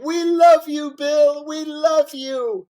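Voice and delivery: very high, loud, slow weepy cry; mock crying crowd. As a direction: imitate a room of sobbing supporters. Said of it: "everybody's crying".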